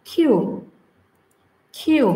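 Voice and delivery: "কিউ" said as kyu, teacher modelling; crisp "কিউ" for students; correct Q. Shown as a demonstration. Teacher models "কিউ" as English kyu. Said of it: "এটা আসলে সঠিক উচ্চারণ হবে".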